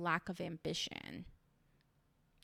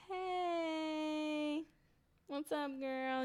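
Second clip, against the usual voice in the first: high, long drawn-out "hey"; mock friendly greeting; 8 semitones higher, much slower than usual. Two rivals greeting each other beside Brian, mock friendly. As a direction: imitate a sugary, awkward hello.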